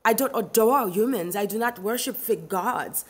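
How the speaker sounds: normal talking voice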